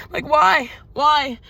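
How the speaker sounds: ordinary delivery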